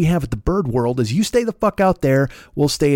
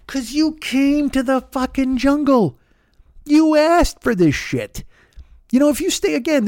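high, slow, drawn-out indignant rebuke; mock scolding; 9 semitones higher than usual. Owl lecturing people who came to the jungle, mock scolding. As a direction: hammer it home slowly, pitched up.

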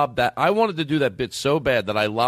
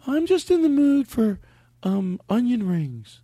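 put-on high, slow, dreamy girly drawl; mock girl voice; 11 semitones higher, slower than usual. Host mocking his date, high and slowly drawn out. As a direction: linger on the craving like a picky date.

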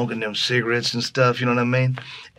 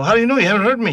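much higher, louder indignant comeback; mad retort; 11 semitones higher, 6 dB louder, much faster than usual. Retold or imitated. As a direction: fire back, offended. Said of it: "I got mad".

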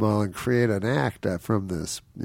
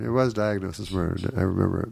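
normal talking voice

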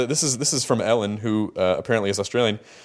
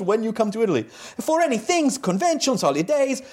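higher-pitched hammed-up Italian accent; 8 semitones higher than usual. Interviewer reading an Italian fan's letter to David, mock Italian accent. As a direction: ham up a broken-English Italian fan. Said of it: "I'm gonna do another accent".

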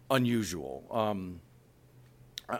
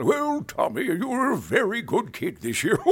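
put-on much higher, louder jolly old Santa; Santa Claus voice; 12 semitones higher, 7 dB louder than usual. Santa praises a good kid in a Hallmark ad, jolly. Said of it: "doing Santa Claus".

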